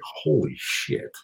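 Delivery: slow, breathy awed "holy shit" under the breath; the publisher reacting to the story, awed whisper; stunned whisper. Guy's stunned reaction retold.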